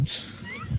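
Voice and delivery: high unvoiced giggle; high squeal laugh. Real, not acted. Laughing at drunk photo.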